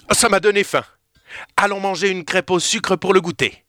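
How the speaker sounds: looking forward to a treat; cheerful hungry boy. Acted. Théo gourmand, ravi. (garçon gourmand et enjoué, plus aigu)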